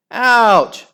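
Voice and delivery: high loud ouch; pained scream. A narrator's reading. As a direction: scream out in pain. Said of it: "screamed Soraya".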